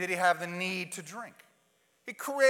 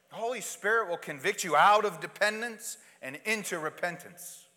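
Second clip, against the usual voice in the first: usual speaking voice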